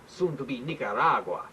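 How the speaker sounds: ordinary delivery, nothing marked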